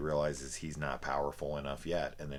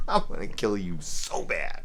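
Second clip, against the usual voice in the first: put-on louder, bratty kid's threat; mocking childish threat; 3 semitones higher, 8 dB louder than usual. Reviewer mocking the villain's bad line, bratty mock threat. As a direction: toss it off like a playground taunt. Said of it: "The line is so bad".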